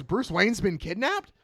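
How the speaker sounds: high, slowed, rising disbelief; shocked echo question. Retold or imitated. Boss echoes Peacemaker's news, shocked. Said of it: "And she goes".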